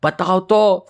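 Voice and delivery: mildly acted envious indignation; jealous griping. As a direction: begrudge a sister her good luck. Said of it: "उसके पैसे से चिढ़ रहे".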